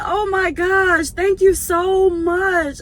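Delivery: high, slow and moved; slow overwhelmed gratitude; touched thank you. Retold or imitated. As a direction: thank her, deeply touched. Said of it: "I said".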